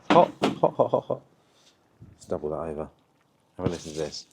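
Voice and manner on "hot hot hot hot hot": hot hands yelp. Real, not acted. Grabbing hot meat.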